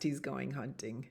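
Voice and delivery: usual speaking voice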